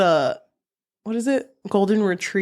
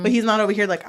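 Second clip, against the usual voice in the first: ordinary delivery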